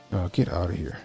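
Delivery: normal talking voice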